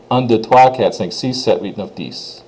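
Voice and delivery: rapid one-to-ten in French; quick French counting. Shown as a demonstration. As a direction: rattle off French numbers without a pause. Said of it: "where you can say it quickly".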